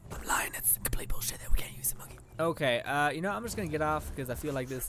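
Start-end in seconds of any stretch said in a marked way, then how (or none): 0.1-2.2 whispered confession aside. Real, not acted. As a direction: let slip the truth in a whisper.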